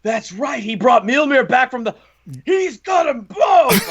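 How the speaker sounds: rising from eager to high, loud, slow shout; building excited yell. Retold or imitated. Fan cheering Thor's two hammers, building to a high shout. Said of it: "and I just went".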